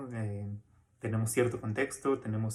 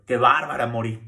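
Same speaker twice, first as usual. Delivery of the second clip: loud, teasing singsong exclamation; playful Mexican catchphrase; 11 dB louder than usual. Shown as a demonstration. Teacher demonstrating a Mexican saying's playful tone. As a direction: toss it out like a cheeky street joke. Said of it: "la entonación con lo que lo decimos".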